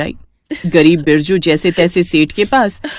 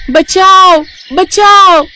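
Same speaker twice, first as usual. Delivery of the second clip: high, loud desperate call for help; cry for help; 12 semitones higher, 7 dB louder, much slower than usual. Acted. Trapped fairy calling out for help, high and loud. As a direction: shout for rescue from far inside the bottle. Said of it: "उसे किसी की आवाज आती है".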